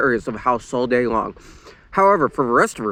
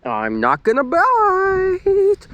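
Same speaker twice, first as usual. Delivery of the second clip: high, very slow mock-whiny tease; fake-crying taunt; much slower than usual. A narrator's reading. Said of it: "Luann taunted".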